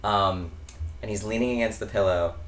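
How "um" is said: ordinary talk, nothing marked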